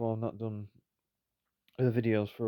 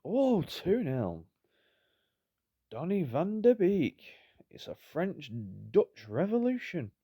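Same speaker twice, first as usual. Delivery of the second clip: high, loud mock commentator, drawn out; excited goal commentary; 6 semitones higher, 5 dB louder, slower than usual. Player commentating his own second goal, hammed-up excitement.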